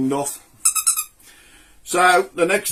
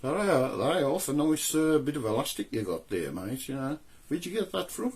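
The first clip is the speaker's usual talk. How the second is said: off-mic aside; 12 dB quieter than usual. Real, not acted.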